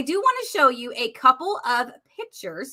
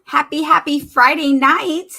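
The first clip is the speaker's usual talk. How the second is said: excited greeting; 4 semitones higher, 8 dB louder than usual. Real, not acted. Host opens her Friday night live, excitedly greeting viewers. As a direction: greet the whole audience with real excitement.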